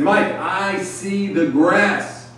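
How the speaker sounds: high, very slow reply stating the obvious; drawn-out obvious answer. Retold or imitated. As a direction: stretch it out high, as if self-evident. Rookie answers veteran's question in retelling.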